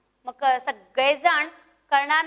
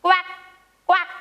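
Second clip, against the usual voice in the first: duck quack; 4 semitones higher, much faster than usual. Shown as a demonstration. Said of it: "बदक हा पक्षी कसा आवाज करतो मग?".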